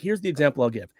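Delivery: ordinary talk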